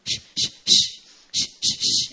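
put-on soothing baby shush. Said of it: "sometimes I shush her".

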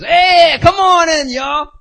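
loud, high acted call-out; hearty welcome shout. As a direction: holler a welcome like a party host. Upperclassman welcomes freshmen into club.

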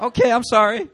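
loud apology. Retold or imitated. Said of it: "I was like".

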